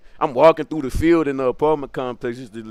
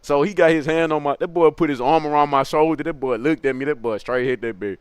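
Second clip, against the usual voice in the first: ordinary delivery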